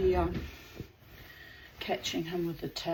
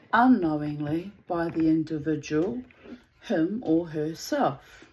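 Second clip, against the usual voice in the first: louder, measured storytelling voice; story narration; 2 semitones lower, 7 dB louder than usual. Acted. Narrator reading the tale of Polycrates aloud, measured and louder. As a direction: read the myth out with weight. Reads a Greek myth.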